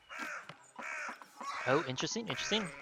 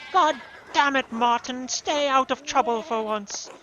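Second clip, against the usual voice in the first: higher-pitched scolding, lightly acted; exasperated aunt; 10 semitones higher, 7 dB louder, slower than usual. Aunt warning Martin off trouble, exasperated scolding. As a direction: scold a wayward nephew in a raised pitch.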